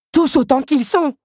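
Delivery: high, full-throated yell; screamed order. A narrator's reading. Henri orders the puppets to destroy them, screaming himself hoarse. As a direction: yell your throat out commanding. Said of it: "s'égosillait Henri".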